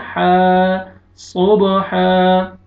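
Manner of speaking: हा held long, सोब with a bouncing b; long madd. Shown as a demonstration. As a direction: stretch every हा and bounce the b of सोब. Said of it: "अलिफ मद्दा हो जाएगा". (हा को खींचकर, सोब पर क़लक़ला)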